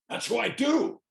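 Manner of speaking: high, loud retold retort; Clint Eastwood. Director defending his voice to his actor, high loud retort. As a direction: push it up high and loud, as imitation. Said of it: "And he goes".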